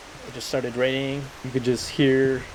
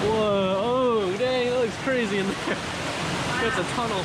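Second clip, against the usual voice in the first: awed; 8 semitones higher than usual. Real, not acted. Awed entering a cave.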